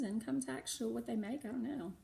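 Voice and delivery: usual speaking voice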